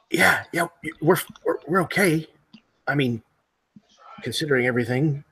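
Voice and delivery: a wary stranger caught off guard; nervous male survivor. Acted. Hiding survivor answering the group, nervous and hesitant. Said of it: "a male voice says".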